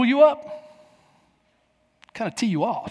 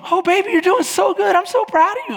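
much higher sweet cooing voice; sweet wife; 10 semitones higher than usual. Retold or imitated. Wife praising her husband on the slope, high cooing. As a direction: coo it like a proud cheerful wife. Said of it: "She goes".

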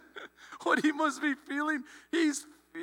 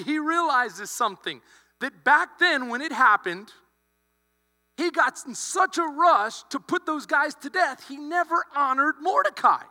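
ordinary delivery

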